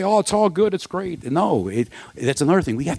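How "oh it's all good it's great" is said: high, sing-song fake brightness; mock cheery denial. Mocking Christians who pretend all is fine, fake bright. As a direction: brush pain off with forced cheer. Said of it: "we don't have to say".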